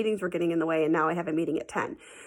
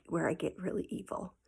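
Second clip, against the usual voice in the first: sly whisper; 8 dB quieter than usual. Real, not acted. Mischievous aside.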